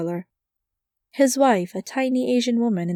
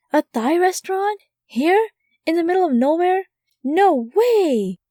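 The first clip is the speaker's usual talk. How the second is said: high, astonished squeals of delight; gasping at a lucky find, pitched way up; amazed disbelief; 9 semitones higher, 4 dB louder than usual. Acted. Woman stunned to find a Thai restaurant here, high and amazed. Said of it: "I was surprised".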